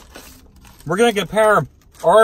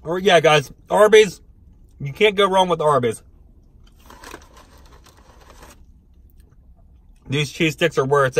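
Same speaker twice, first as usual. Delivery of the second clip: biting food while talking. Real, not acted. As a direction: mouth full, chewing. Seen 2: Chews with mouth closed first, then hand brings stick to mouth, biting.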